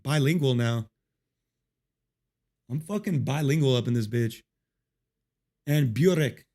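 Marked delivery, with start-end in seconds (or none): 5.6-6.5 Serbian word with a German-accented ring; lightly German-accented pronunciation. Real, not acted. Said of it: "like I would be doing a German accent".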